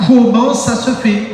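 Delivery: high, slow, exasperated question; frustrated question. Acted. Believer asking the Lord why nothing comes, exasperated. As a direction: draw out the question, high and baffled. Acts out complaint to God.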